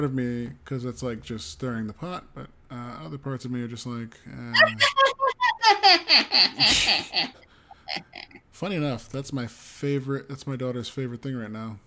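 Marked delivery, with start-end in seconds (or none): 4.6-7.3 big laugh. Real, not acted. Laughs at stirring the pot.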